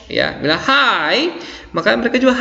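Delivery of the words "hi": high, lively hi; spirited hi. Shown as a demonstration. Teacher modelling what you say to greet someone, spirited. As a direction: lift the greeting with energy. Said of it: "ada semangat ketika kita berbicara".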